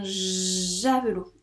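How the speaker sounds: "javelot" with a long, held j at the start; slow "javelot", first consonant drawn out; stretched j sound. Shown as a demonstration. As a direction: hold the buzzing j, then finish "javelot". Teacher stretches the j for pupil.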